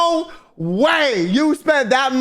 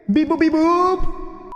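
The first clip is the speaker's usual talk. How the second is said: robot beeping; 5 semitones higher, much slower than usual. Pretend. Cohost makes robot noises at guest.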